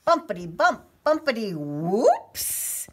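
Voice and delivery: loud and lively, slowing on whoops; bumpy ride. A narrator's reading. Storyteller acting the bumpy leaf ride, lively. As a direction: bounce the words like a bumpy ride. Leaf bumps over grass.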